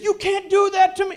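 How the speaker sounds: the pregnant wife protesting on the phone; wife's high-pitched protest; upset wife. Retold or imitated. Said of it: "And she said".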